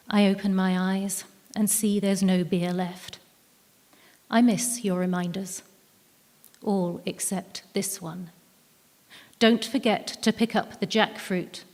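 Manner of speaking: normal talking voice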